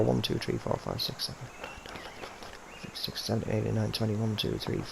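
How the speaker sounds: quiet whispered count under breath. Real, not acted. Whispered counting of putt slope.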